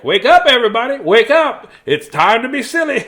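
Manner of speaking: playful mock wake-up shout; excited brain. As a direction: rouse everyone like an eager alarm. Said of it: "my brain will be like".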